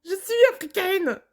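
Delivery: insistent outcry, pitch climbing high; excited demand. Real, not acted. Reactor begs to hear the African accent, excited and insistent. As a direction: demand it eagerly, voice shooting up.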